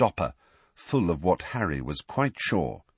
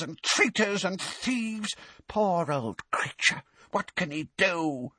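put-on higher, hoarse grumbling old elf, lamenting; hoarse elf muttering; 8 semitones higher than usual. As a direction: grumble bitterly to yourself like an old bullfrog of an elf. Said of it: "muttering under its breath in a hoarse, deep voice like a bullfrog's".

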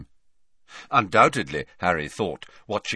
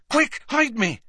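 high-pitched urgent cry; urgent panic; 4 dB louder than usual. A narrator's reading. Boy ducking behind his friend, urgent mock panic. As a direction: blurt it out, desperate to hide.

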